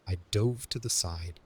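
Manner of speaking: ordinary delivery, nothing marked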